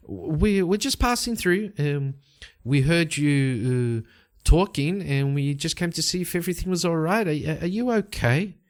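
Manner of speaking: tentative and high; concerned passer-by. Acted. Leda gently checks on frightened mage.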